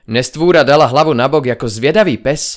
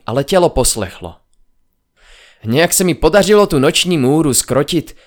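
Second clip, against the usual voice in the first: plain reading voice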